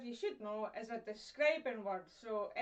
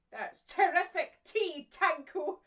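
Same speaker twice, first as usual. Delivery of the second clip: louder, slow, hard t in "terrific tea"; harsh T's; 4 semitones higher, 8 dB louder, slower than usual. Shown as a demonstration. Thanking husband for tea, hard t's. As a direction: spit the t's at him. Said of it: "harsh letters are things like G, P, T".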